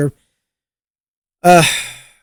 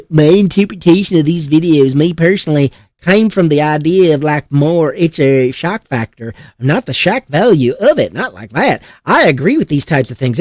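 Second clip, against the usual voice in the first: badly faked, lilting Kentucky accent; terrible Kentucky accent. Cop excusing his videos, in a terrible Kentucky accent. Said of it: "that was a terrible Kentucky accent".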